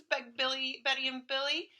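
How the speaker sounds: plain reading voice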